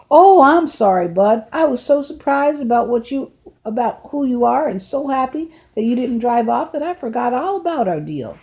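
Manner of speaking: a hint of a genial older man; an older driver talking to a runaway boy, lightly voiced. Lefty apologizes.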